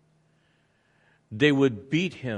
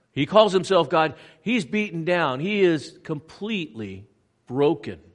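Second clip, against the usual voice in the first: higher, louder, urgent; fervent preaching; 5 semitones higher, 5 dB louder than usual. Real, not acted. Preacher at the holy site, urgent. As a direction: drive the point home.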